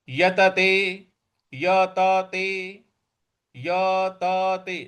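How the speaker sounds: यतते with every syllable said fully, slow; full syllables. Shown as a demonstration. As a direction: say each syllable of यतते slowly. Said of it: "पूरा बोलना है". (पूरा उच्चारण)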